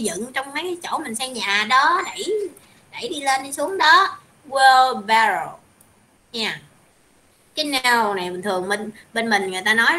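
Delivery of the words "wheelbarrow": Wheelbarrow said slowly and clearly; slow model word. Shown as a demonstration. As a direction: spell out Wheelbarrow slowly for the class. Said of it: "teacher models the word". (đọc mẫu chậm rãi chữ Wheelbarrow)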